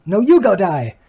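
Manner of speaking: high, loud bratty retort; childish yelled comeback. Retold or imitated. The second kid yells the insult back, high and loud. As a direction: yell it back like a kid losing the argument.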